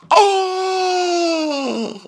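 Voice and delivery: long high wailed "oh"; hammed-up cry of dismay; Tariq. Reacting to castmates' insults, mock high wail. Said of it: "And Tariq goes".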